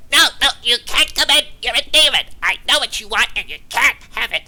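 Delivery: squeaky high gnome voice yelling out loud; a tiny frantic gnome shooing a demon away; panicky gnome. Acted. Gnome shop owner refusing demon.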